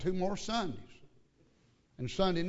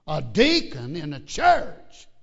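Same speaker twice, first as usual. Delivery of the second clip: loud preaching; 2 semitones higher, 7 dB louder, slower than usual. Real, not acted. Preacher scolding in sermon.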